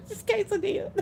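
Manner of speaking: QUESADILLA said properly as kay-suh-DEE-uh; correct pronunciation. Shown as a demonstration. Explaining to the audience how it's said, correctly pronounced. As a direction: say "quesadilla" cleanly with no L sound. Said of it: "why can't nobody pronounce quesadilla".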